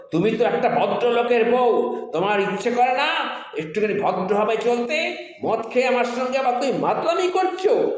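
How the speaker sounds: high-pitched acted husband's rebuke; indignant scolding. Husband scolds wife after slapping her.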